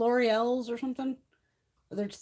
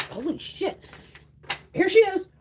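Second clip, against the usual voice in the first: relieved exclaim; 11 semitones higher, 6 dB louder than usual. Real, not acted. Cat finally comes.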